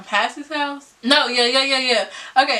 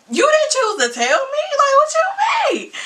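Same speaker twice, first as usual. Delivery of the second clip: indignant disbelief; 13 semitones higher, faster than usual. Retold or imitated. To boyfriend who kept a secret, high indignant disbelief. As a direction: throw the question back in disbelief. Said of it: "retold confrontation".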